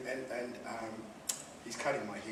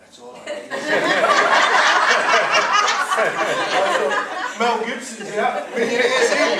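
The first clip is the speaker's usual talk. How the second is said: laughing through the words, loud and high; 10 semitones higher, 18 dB louder, much slower than usual. Real, not acted. Laughing to the audience about getting rich, laughing loudly. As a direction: chuckle it out, slow and amused. Laughing at his own dream.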